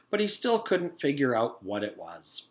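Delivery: plain reading voice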